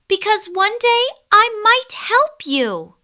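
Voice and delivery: very high, loud squeaky voice; little mouse bravely answering the lion, high and squeaky. Acted. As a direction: stand tall and squeak it proudly.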